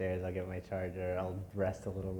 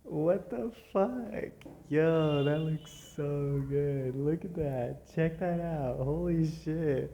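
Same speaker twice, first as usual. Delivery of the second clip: high, loud and drawn-out amazement; can't believe how good it looks; amazed excited; 8 semitones higher, 5 dB louder, slower than usual. Real, not acted. Sees finished coat, amazed.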